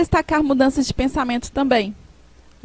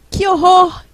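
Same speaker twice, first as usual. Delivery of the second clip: a much higher, louder "Que horror!"; horrified exclamation; 6 semitones higher, 7 dB louder than usual. Shown as a demonstration. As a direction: recoil at something awful, out loud. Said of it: "Ou horror ou espanto".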